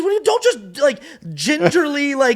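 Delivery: high, loud exasperated shout, slowing at the end; angry director yell. Retold or imitated. Director Kevin yelling at the actors after cut, high and loud. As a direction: bellow at them like a fed-up director. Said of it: "he goes".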